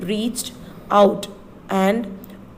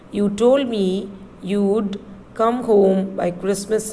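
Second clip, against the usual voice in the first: ordinary talk, nothing marked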